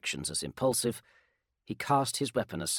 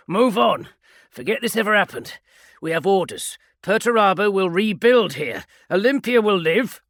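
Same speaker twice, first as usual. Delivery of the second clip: stern sergeant; 10 semitones higher, 11 dB louder than usual. Acted. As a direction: hammer home obey or die. Sergeant orders.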